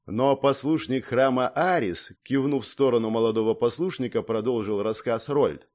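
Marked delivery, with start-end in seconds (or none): none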